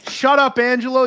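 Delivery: high, loud shut-up; angry shout. Real, not acted. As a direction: cut him off sharply. Yelling at commenter Angelo.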